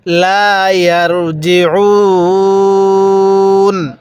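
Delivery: slow Quranic recitation with a held "ইয়ারজিউন"; elongated recitation. Shown as a demonstration. Teacher showing learners how to stop on ইয়ারজিউন, long drawn-out recitation. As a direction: draw out the last vowel of ইয়ারজিউন very long. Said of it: "তিন আলিফ টান দিবেন".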